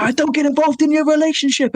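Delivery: very high strained mock scream; escalated, shrieked retelling; imitated screaming. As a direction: scream it like someone losing it in a row. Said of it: "screaming at the top of their lungs".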